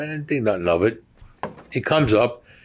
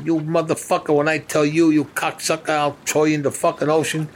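higher-pitched angry tirade, imitated; mock yell, pitched up, not full volume; retold yelling; 7 semitones higher than usual. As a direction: re-enact a man cursing someone out. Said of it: "I hear him yelling".

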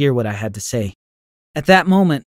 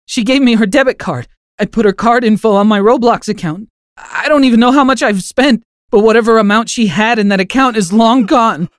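high, loud sobbing confession; boy sobbing out his confession to his parents; 8 semitones higher, 5 dB louder than usual. Acted. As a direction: push the words out through sobs. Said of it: "I sobbed".

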